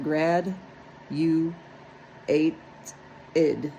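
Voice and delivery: grad, you, ate, id said as separate beats with pauses; split into syllables. Shown as a demonstration. Teacher to students, chopping the word into syllables. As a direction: sound it out piece by piece.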